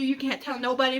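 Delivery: ordinary delivery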